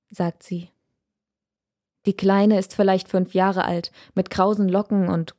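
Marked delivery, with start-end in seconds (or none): none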